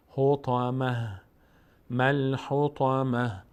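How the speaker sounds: drawn-out, measured Quranic syllables; slow recitation demo. Shown as a demonstration. Teacher modelling the word for learners, slow recitation. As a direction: stretch each syllable evenly.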